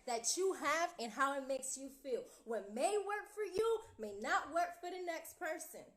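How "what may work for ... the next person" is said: louder and higher, pressing each word; emphatic declaration. Real, not acted. Coach stresses to viewers, loud emphatic delivery. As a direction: drive the point home. Repeats key line for emphasis.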